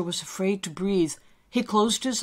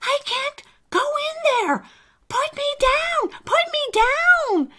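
very high, louder squealing protest; panicked cry; 6 dB louder than usual. Acted. Alistair wriggles on his friend's shoulders, panicked. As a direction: squeal frantically to be let go. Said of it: "Alistair cried".

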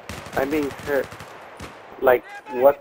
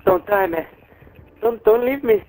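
desperate pleading; 9 semitones higher, faster than usual. Real, not acted. Pleading with dying teammate.

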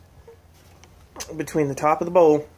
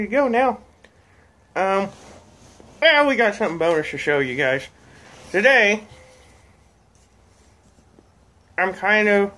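talking around pipe stem. Real, not acted. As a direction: pipe clenched in teeth. Seen 3: pipe in hand at first, clenched in teeth from 0.8s.

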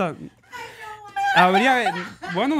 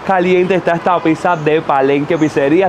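louder, energetic sponsor read; anuncio publicitario; 3 semitones lower, 5 dB louder than usual. Acted. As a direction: sell the pizza to the podcast audience, loud. Lee publicidad de la pizzería. (anuncio publicitario con voz fuerte)

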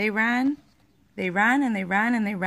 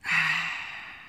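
relieved sigh; 4 semitones lower than usual. A narrator's reading. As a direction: let the breath out with relief. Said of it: "He sat down in the water and sighed".

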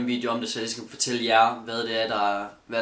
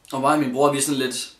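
ordinary delivery

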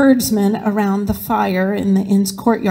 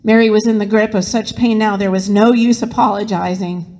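ordinary delivery

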